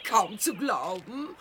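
delighted laugh, then soft. Acted. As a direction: laugh in amazement. Reporterin lacht begeistert. (lachend, staunend)

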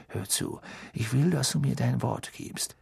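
hushed, urgent undertone. A narrator's reading. Father demanding the boy's promise, hushed and urgent. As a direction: drop the voice and press him. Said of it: "sprach leiser und hastiger weiter".

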